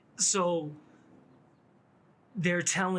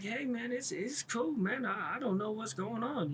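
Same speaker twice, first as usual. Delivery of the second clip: higher, overly casual innocent voice; playing innocent; 6 semitones higher, 4 dB quieter than usual. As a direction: act like nothing's wrong, pitched up.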